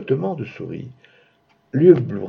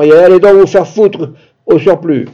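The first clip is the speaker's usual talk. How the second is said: loud, raised-pitch outburst; angry shout; 3 semitones higher, 7 dB louder than usual. A narrator's reading. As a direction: yell it at her in exasperation. (cri de colère, exaspéré)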